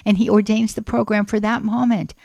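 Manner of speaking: usual speaking voice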